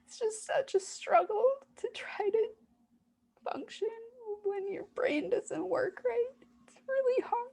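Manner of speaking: high, breaking voice through real sobs; real crying. Real, not acted. A frightened inner part pleading to be believed, crying. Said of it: "this part that's at the surface right now and just expressing this fear".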